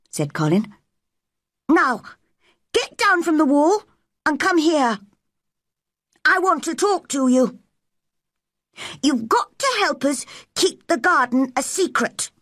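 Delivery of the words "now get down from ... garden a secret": put-on high-pitched, bossy boy's orders; commanding boy. A narrator's reading. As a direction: order the old gardener about as a boy. Boy gives orders to gardener.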